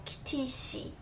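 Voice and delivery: "Kitty she" with a tense S opening "she"; S sound. Shown as a demonstration. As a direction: model "Kitty she", S sound on the last syllable. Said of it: "an S sound".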